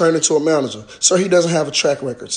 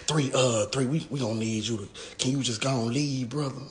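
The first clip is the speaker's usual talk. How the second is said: hushed request; 8 semitones lower, 13 dB quieter than usual. Retold or imitated. Officer quietly telling the rapper to leave, low and hushed. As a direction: voice dropped low and soft like a cop asking him to go. Acting out police asking him to leave.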